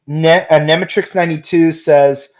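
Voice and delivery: usual speaking voice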